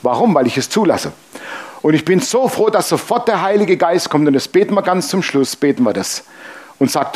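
ordinary talk

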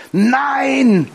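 high, drawn-out no; imitated defiant refusal. Acted refusal by unforgiving woman.

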